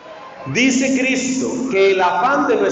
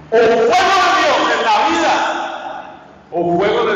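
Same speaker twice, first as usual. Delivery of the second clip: loud, higher cry on the fire; preaching shout; 4 semitones higher, 4 dB louder than usual. Acted. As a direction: hurl the warning at the congregation. Preacher's fiery either-or warning. (grito de advertencia)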